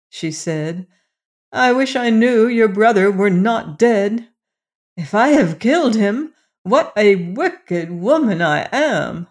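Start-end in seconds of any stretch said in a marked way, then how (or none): 1.5-9.3 loud, high lament; remorseful outcry. A narrator's reading. Queen regrets killing her stepson, lamenting aloud. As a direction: wish it aloud with anguish.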